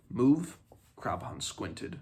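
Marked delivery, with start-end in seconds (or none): 0.1-0.6 a loud, rising, startled question; surprised. Acted. Krabhan startled by Megwyn's plan, louder surprised question. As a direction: caught off guard by the plan.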